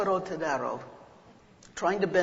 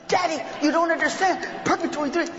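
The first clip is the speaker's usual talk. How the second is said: put-on high, pleading kid's voice; a little kid begging Daddy to listen; pleading child; 10 semitones higher, 5 dB louder than usual. Small child pleading with his father, high and upset.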